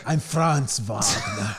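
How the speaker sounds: put-on slow, shouted German-accented Franz Wagner; mock German accent, shouted. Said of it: "I sound like Arnold".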